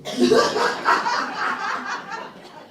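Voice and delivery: high hearty cackle at her own joke; real loud laugh at her own line. Real, not acted. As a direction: throw back a big laugh.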